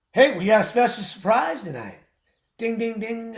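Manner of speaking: big showman reveal; hyped announcement. Acted. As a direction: ring it in like a prize bell. Announcing a special surprise deal.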